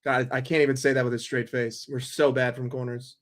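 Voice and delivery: ordinary talk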